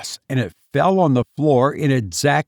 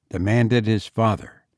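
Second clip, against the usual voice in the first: ordinary delivery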